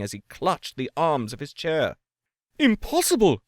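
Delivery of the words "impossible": high, loud exclamation; emphatic denial. A narrator's reading. Lawyer rejecting the chimney idea, loud and high. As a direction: reject it outright. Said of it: "said the lawyer".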